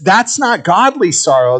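normal talking voice

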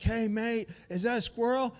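imitating a thrilled tourist, high and loud; high-pitched, eager question; excited Australian golfer. Australian golfer spots a squirrel and asks his partner, excited imitation. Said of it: "this guy was from Australia".

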